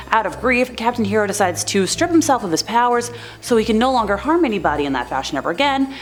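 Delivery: normal talking voice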